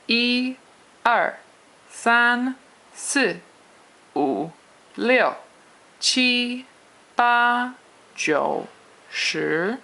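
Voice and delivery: "一", "三", "七", "八" held high and level, "二", "四", "六" falling sharply; exaggerated Mandarin tones. Shown as a demonstration. Teacher drilling Chinese numbers for learners, exaggerated tones. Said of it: "Chinese pronunciation with the tones".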